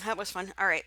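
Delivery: normal talking voice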